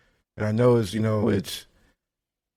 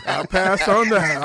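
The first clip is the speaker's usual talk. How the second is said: laughing; 6 semitones higher, 3 dB louder, slower than usual. Real, not acted. Laughing refusal of sweat wine.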